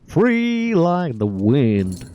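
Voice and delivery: high, hammed-up silly character voice; Pyrotoys bit voice. Gamer mocking his hero as Pyrotoys, hammed-up high voice. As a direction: ham up a goofy over-the-top hero. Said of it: "Pyrotoys is still in my voice".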